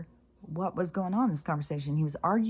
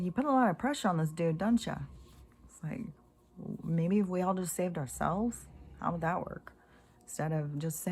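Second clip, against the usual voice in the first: usual speaking voice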